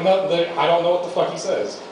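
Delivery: normal talking voice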